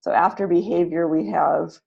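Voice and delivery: ordinary delivery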